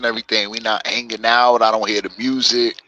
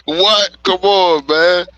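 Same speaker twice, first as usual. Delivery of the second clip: put-on Jamaican Rasta accent; 8 semitones higher, 4 dB louder than usual. Mocking Jamaican Rasta culture.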